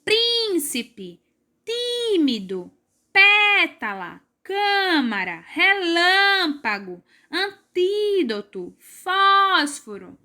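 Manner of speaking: each word slow, strong syllable high and stretched: PRÍN, TÍ, PÉ, CÂ, LÂM, TÍ, FÓS; slow stressed syllables. Shown as a demonstration. Teacher modelling words for finding the strong syllable, slow and stressed. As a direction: call each word out slowly, lifting the accented syllable of Príncipe through Fósforo. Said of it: "falar as palavras devagar e em voz alta".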